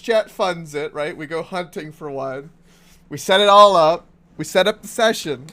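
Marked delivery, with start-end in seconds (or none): 3.1-5.5 loud, eager planning; pumped up about the plan; excited. Real, not acted.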